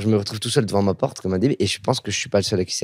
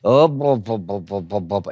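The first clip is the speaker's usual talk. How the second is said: replaying his locksmith bit; slower than usual. Shown as a demonstration. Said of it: "J'ai commencé à faire des".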